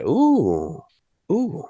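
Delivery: lightly imitated drawn-out ooh; soft, intrigued ooh in imitation; Jeff Goldblum. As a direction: savour it like a quirky movie star. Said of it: "The Jeff Goldblum".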